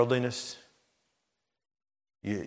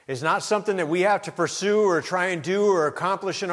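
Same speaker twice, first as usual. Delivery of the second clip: full-force preaching; 10 semitones higher, 6 dB louder than usual. Acted. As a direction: preach it up to the climax.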